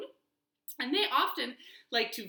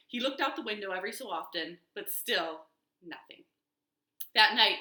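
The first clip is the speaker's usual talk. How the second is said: ordinary delivery, nothing marked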